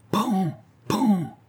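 sharp high pan, pan like knocks; knocking sounds. Acted. Miner imitating the knocks on the tree, high and sharp. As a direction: mimic the blows on the tree.

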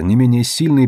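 plain reading voice

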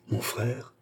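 soft, stunned murmur. A narrator's reading. Andrée learns her brother was the duellist, murmuring. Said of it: "murmura André".

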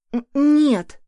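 high, stammering pant while climbing; puffing. A narrator's reading. Son climbing the tree, out of breath. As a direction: huff the words out mid-climb. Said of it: "пыхтя пробормотал сынок".

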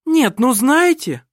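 slow, emphatic outcry; indignant exclamation. A narrator's reading. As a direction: exclaim in offended outrage. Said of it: "воскликнула Лисин".

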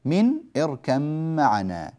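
"من اركب معنا" with the ba merged into a doubled "مـ"; assimilation demo. Shown as a demonstration. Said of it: "فالباء مع الميم".

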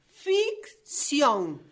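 "Ficción" slowly split in two, each half stressed; emphatic insistence. Acted. Author insisting to her character, "Ficción" said emphatically in pieces. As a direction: hammer out "Ficción" syllable by syllable.

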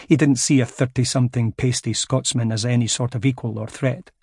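usual speaking voice

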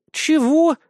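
high, loud shocked yelp; incredulous. A narrator's reading. Raccoon dog reacts to being called smitten, incredulous. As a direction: shoot the pitch up in disbelief. Shocked reply to love accusation.

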